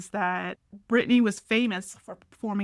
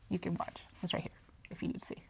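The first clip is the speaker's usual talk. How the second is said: whisper; 3 semitones lower, 13 dB quieter, faster than usual. Real, not acted. Aside to viewers.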